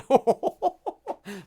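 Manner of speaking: high madman cackle; maniacal cackle. Pretend. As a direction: cackle like a lunatic. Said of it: "They should have had him do".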